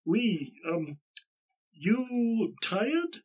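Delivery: high, slow broken-English greeting; halting foreign villager. Acted. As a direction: feel out each word like a villager with little English. Plays local man with little English.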